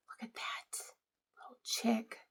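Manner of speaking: soft hushed whisper over the cards. Real, not acted. Admiring Easter cards from Jean, a soft whisper. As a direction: whisper it as if not to break the spell.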